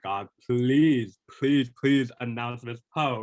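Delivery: mock pleading prayer, drawn out; a touch of desperate prayer; retold prayer. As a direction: beg God with a slow plea. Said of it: "I was like".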